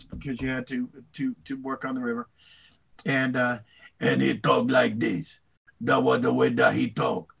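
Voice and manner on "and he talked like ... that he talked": a hint of Louisiana Cajun speech; Cajun accent. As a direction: slip into the old Cajun captain's accent. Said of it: "he was a Cajun".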